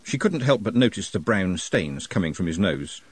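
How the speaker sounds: plain reading voice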